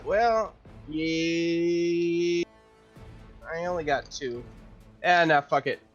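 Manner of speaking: normal talking voice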